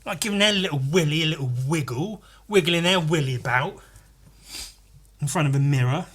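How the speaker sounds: ordinary talk, nothing marked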